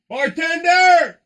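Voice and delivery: high, long, loud holler; half-sung shout across a bar; loud singsong yell. Real, not acted. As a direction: yell it out for service, drawn out. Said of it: "Excuse me for the loud singing or yelling".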